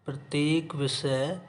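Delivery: usual speaking voice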